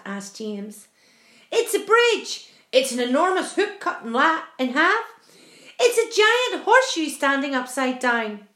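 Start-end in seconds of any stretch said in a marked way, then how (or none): 1.5-8.5 loud, raised exclamations; excited guessing. A narrator's reading. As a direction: call out each guess excitedly. Travellers guess excitedly at the arch.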